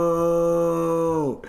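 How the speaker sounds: high, stretched-out mock refusal; long exaggerated no; drawn-out mock no. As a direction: pretend to be appalled.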